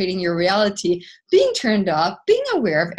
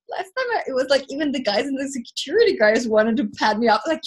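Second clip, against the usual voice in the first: ordinary delivery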